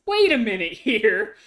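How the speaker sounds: high-pitched mock disbelief, rising into a question; incredulous reaction. Player reacting to the murder mystery's twist, incredulous. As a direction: act out being floored by a plot twist.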